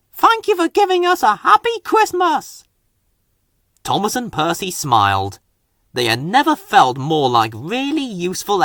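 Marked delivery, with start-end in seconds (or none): 0.1-2.6 high happy cheering; grateful villagers. A narrator's reading. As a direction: call out thanks with joy. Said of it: "they cried".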